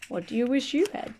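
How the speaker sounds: plain reading voice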